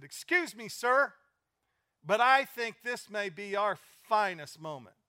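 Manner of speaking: loud, slow, high defiant declaration; loud, defiant correction of the officials; firm confrontation. Retold or imitated. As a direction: stand up to them and declare it slowly. Ed Harris confronting the congressmen.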